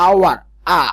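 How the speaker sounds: "আওয়ার" with silent h, then "আ" alone; sounded-out first vowel. Shown as a demonstration. Teacher showing hour starts with a vowel, sounded out. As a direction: say "আওয়ার", pause, isolate its opening "আ". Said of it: "এ এর মতো উচ্চারণ হচ্ছে".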